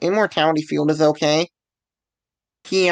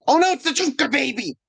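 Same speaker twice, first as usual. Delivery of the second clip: high-pitched mock-dramatic cry; mock alarm; 9 semitones higher than usual.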